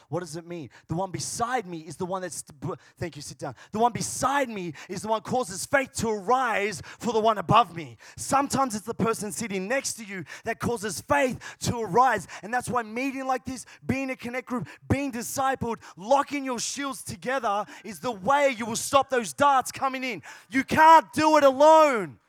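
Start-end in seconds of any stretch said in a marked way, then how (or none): 3.7-20.2 loud, high, rousing preacher delivery; full-force preaching. Real, not acted. Preacher urges congregation to lock shields together, loud impassioned preaching. 20.5-22.2 very loud, high, drawn-out declaration; preacher's climactic shout. Real, not acted. Preacher tells congregation nobody stands alone, full-force shout. Sermon climax, then softer repeat.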